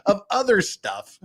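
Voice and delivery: ordinary delivery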